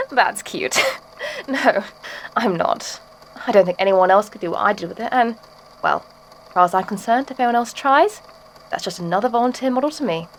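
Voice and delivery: ordinary talk, nothing marked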